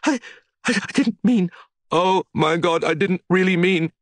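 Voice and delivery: high, shaky stammer; stammering in shock. A narrator's reading. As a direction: stammer it out panicked. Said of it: "She stammered out".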